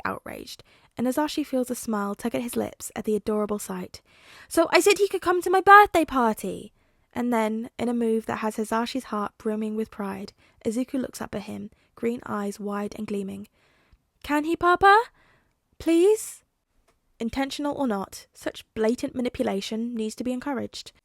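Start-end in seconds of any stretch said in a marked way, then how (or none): 4.5-6.7 put-on a small boy shocked on his friend's behalf; outraged little boy. A narrator's reading. Son tells father his friend never had cake, childish outrage. 14.2-16.4 put-on high, loud child voice begging; wide-eyed small boy asking sweetly; pleading little boy. A narrator's reading. Son begs his father to let his friend come, pleading.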